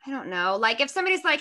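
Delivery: ordinary delivery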